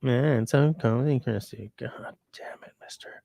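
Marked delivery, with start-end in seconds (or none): 1.8-3.2 breathy whispered grumble under the breath; whispered mock curse at the cat. Real, not acted. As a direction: hiss it under your breath, half amused. Muttered aside to cat.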